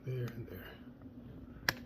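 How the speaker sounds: slow muttered whisper. Real, not acted. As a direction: whisper it slowly to yourself. Muttering to himself while working.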